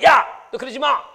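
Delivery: loud sharp scolding yell; retold shout. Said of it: "그러고 막 소리치면". (야단치듯 크게 소리침)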